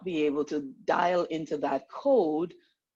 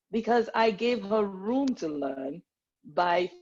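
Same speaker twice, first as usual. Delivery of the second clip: usual speaking voice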